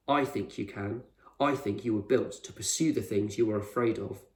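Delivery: ordinary delivery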